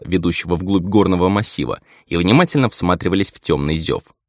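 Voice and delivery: ordinary delivery, nothing marked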